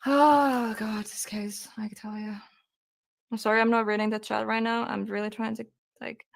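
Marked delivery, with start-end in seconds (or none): 0.1-2.3 close to tears. Real, not acted. Streamer telling the chat about the case, choked up and whispering. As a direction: let it slip out as your composure breaks. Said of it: "I'm trying not to ... get emotional".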